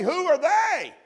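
shouted high in astonishment; amazed shout. Retold or imitated. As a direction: cry out in wonder at the sight. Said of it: "you gotta say".

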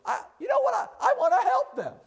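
the king bursts with the idea; hammed-up eager king; excited king.

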